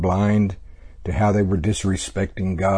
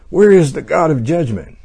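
loud, high mocking demand; mock indignant cry; 7 semitones higher, 7 dB louder than usual. Teacher mimicking the people demanding God's judgment, loud and high. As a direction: cry it out like a smug accuser. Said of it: "They're going".

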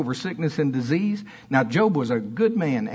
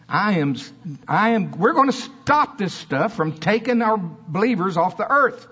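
preaching at full force; 6 semitones higher than usual. Acted. Preacher vowing to the church, rising to full force. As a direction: drive the vow home to the church.

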